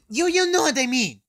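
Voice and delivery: high, loud defensive outburst; defensive yell. Real, not acted. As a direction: shout it back, caught out. Snapping back after being corrected.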